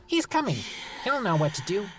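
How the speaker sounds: high, eager gnome voice; thrilled little gnome; excited gnome. Acted.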